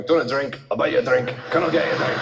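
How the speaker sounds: put-on low Glaswegian accent; mock Glaswegian in a pub; Glaswegian pub-goer. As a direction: put on a Glaswegian man offering drinks. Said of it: "a Glaswegian man in a pub going".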